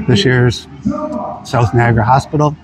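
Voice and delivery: talking while chewing; speaking between bites; mouth full of burger. Real, not acted. Seen 1: Bites burger 1.1–1.8s, chews until about 4s, then gestures empty-handed.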